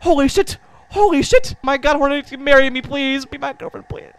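high, loud, giddy pleading; excited gushing. Real, not acted. Streamer begging Hornet to marry him, high and excited. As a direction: gush like a starstruck fan.

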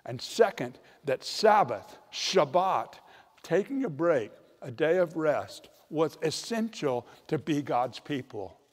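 normal talking voice

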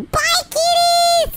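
high, slow drawn-out shout; gleeful yell. Acted. Puppy taunting the kitty as it falls, high gleeful shout. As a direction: yell goodbye with mock triumph.

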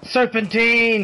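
drawn-out high shout; shouted movie quote. Pretend. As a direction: stretch it out, yelling. Yells movie line while fleeing.

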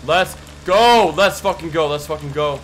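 loud, high victory shout; triumphant yell. Real, not acted. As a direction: scream it in triumph. Celebrating escape.

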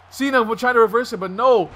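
ordinary delivery

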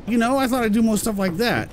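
ordinary delivery

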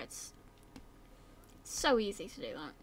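ordinary delivery

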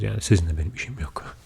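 usual speaking voice

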